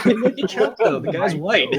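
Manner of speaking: high, loud talking through laughter; laughing. Real, not acted. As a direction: protest while cracking up. Game master cracks up at remark.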